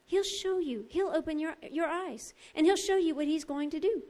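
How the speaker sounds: normal talking voice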